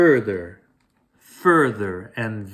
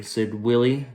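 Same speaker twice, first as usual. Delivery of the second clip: plain reading voice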